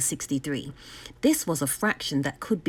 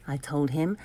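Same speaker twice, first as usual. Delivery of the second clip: ordinary delivery, nothing marked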